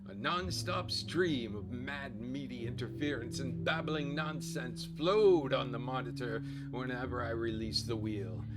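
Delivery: normal talking voice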